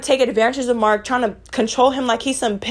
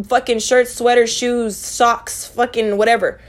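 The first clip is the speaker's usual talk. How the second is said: normal talking voice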